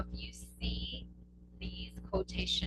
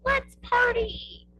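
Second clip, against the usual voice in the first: high, loud and slow whoop of joy; a loud, drawn-out squeal of excitement; excited cheer; 8 dB louder, much slower than usual. Shown as a demonstration. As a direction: celebrate as if the party starts now. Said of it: "So you can be like".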